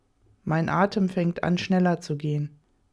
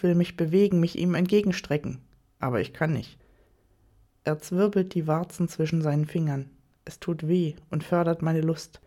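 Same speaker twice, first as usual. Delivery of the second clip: usual speaking voice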